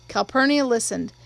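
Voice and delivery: ordinary delivery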